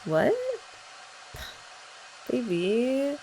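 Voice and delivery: ordinary talk, nothing marked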